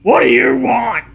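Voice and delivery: lightly voiced old man bellow. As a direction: bark it like a grumpy old man. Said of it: "An old man voice bellows".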